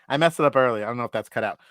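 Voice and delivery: ordinary talk, nothing marked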